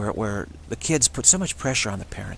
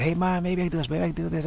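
much higher whiny mock kid; nagging kid; 7 semitones higher, faster than usual. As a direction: wheedle like a nagging child.

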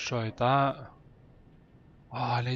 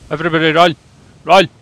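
panicked shout; 5 semitones higher, 15 dB louder, faster than usual. Pretend. Player hams up panic.

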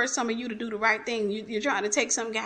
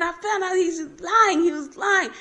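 retold crying complaint; 7 semitones higher, 5 dB louder than usual. Woman telling God about his lies, acted tearful outcry. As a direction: wail it to God as a retelling. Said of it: "I'm just in there crying".

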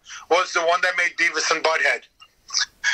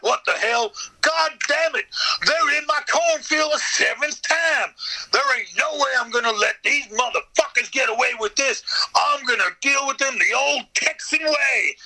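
furious hillbilly yell; 8 semitones higher than usual. Retold or imitated. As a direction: shout at the monsters in the cornfield. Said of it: "And I'm like".

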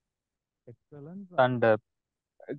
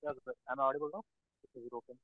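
faint and far from the mic; barely audible over a weak call line; very quiet mic; 15 dB quieter than usual. Real, not acted. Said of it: "still it is very low".

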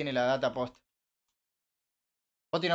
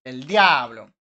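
a loud amazed "El diablo"; loud mock astonishment; 11 dB louder, much slower than usual. Real, not acted. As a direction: exclaim it like something unbelievable. Asombro exagerado. (asombro exagerado y fuerte)

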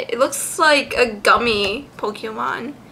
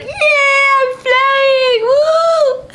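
high, loud squeaky creature cry; high, happy shout for the flying creature; voicing the Pokemon; 13 semitones higher, 4 dB louder, much slower than usual. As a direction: give the little flyer an excited squeal. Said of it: "it's like".

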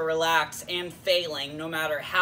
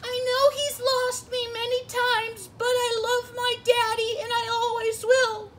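very high, squeaky heartfelt confession; Pikachu. Acted. Sidekick given the spotlight to praise daddy, sentimental squeak. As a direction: declare love in a tiny creature voice. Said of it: "Sure, Pikachu".

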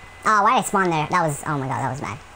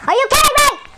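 high, loud disbelieving yell; furious yell; 7 dB louder than usual. Real, not acted. Gamer raging at bad aim, furious yell. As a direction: yell it in pure frustration.

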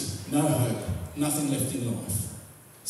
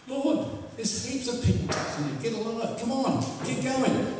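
impatient disciples; 8 semitones higher than usual. Retold or imitated. Disciples hurry Jesus along.

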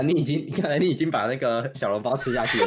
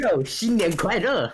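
put-on slow, overdone American-born Chinese accent; fake ABC accent; 4 semitones higher, much slower than usual. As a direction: drawl it like a foreigner faking Mandarin. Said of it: "那种假A B C".

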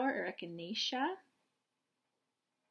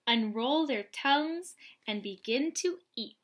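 bright, louder read-aloud storytelling voice; picture book reading; 3 semitones higher, 5 dB louder than usual. Acted. Reading a butterfly picture book to young children, lively and bright.

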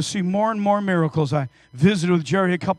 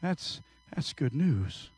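soft, slow contented words; Jerry softly moved by the good news; quiet smiling; 5 semitones lower, 12 dB quieter, slower than usual. Retold or imitated. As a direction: smile it softly. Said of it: "He smiled and said".

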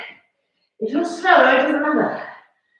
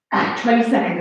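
ordinary talk, nothing marked

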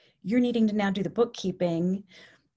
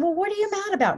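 high, keen, still intense question; slightly eased mirroring; 10 semitones higher, 3 dB louder, faster than usual. Retold or imitated. Caregiver mirroring an angry resident, starting to ease down. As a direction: probe with matched but easing intensity. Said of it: "I brought myself down a little bit".

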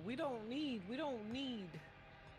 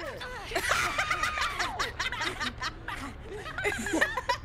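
loud high laugh; 11 semitones higher, 9 dB louder than usual. Real, not acted. Player laughing at a teammate's escape, loud and high.

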